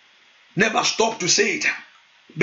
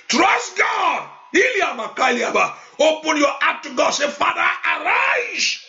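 fervent prayer; 6 semitones higher than usual. Acted. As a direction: declare it over the congregation. Fiery prayer session.

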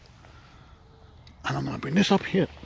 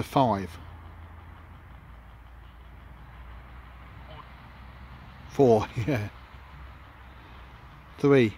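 called out countdown; much slower than usual. Real, not acted. Ghost hunter counting down aloud to the spirits. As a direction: call each number out into the dark.

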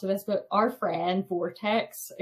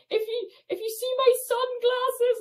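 high call across a distance; 3 dB louder than usual. Retold or imitated. Asking Vortex to grab her sunglasses, high call across the distance. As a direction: call after her, hopeful.

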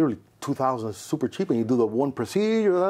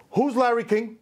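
[name] asking; 8 semitones higher than usual. Retold or imitated. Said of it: "[name] arrives".

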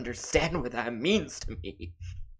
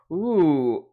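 ordinary delivery

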